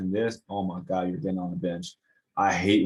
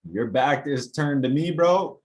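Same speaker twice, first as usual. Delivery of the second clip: mocking taunt; 6 semitones higher, 4 dB louder, slower than usual. Taunting defender on film.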